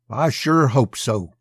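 slow, hateful retort with mild force; hateful spit. A narrator's reading. Jubal Allen answers the graying officer, spitting with understated hate. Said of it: "he spat. The hate had not left his voice".

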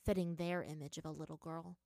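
ordinary delivery

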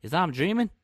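high, mock-incredulous reaction; acted disbelief. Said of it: "He'll look at you like".

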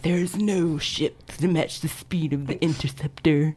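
whispered movie-line quote, hushed and grand; dramatic movie whisper. Retold or imitated. Quoting Pirates of the Caribbean to her co-hosts in a dramatic whisper. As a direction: breathe it like a secret pirate legend. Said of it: "And I was like".

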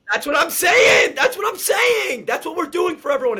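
loud, high excited yelling; excited shout. Real, not acted. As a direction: shout it with hyped enthusiasm. Excited agreeing about the promo.

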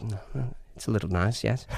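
soft, understated British voice; British accent. Retold or imitated. American slipping into British talk, soft British accent. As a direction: murmur it like a Brit. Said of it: "hang out with too many Brits".

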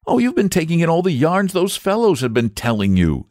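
put-on high, exasperated young boy; impatient boy. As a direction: brush it aside impatiently. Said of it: "said the boy impatiently".

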